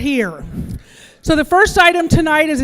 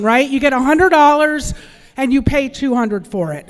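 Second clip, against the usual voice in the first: usual speaking voice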